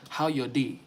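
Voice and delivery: Nigerian Pidgin English, "How your day?" tossed off lightly; Nigerian Pidgin greeting. Shown as a demonstration. Explaining to friends how Nigerians speak, light Pidgin greeting. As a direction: clip it short the Nigerian Pidgin way. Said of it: "I'll say".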